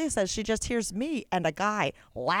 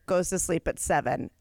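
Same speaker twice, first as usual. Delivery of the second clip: normal talking voice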